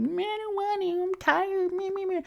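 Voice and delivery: put-on high, whiny toddler voice; whiny inner toddler. Her inner toddler refusing the gym, high whine. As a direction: whine like a tired little kid. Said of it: "my inner toddler wants to be like".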